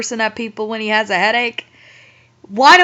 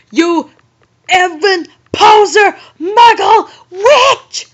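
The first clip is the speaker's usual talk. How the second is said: a mock sob breaking into a high, loud yell; hammed-up crying shout; 9 semitones higher, 8 dB louder, slower than usual. Reading the fanfic's villain, wailing the insults at Ebony. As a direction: wail the insults louder and higher with each word. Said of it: "he shouted".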